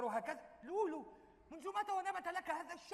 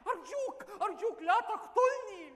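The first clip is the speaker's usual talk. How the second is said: mock pleading; 6 semitones higher, 7 dB louder than usual. Begging the robber for his life.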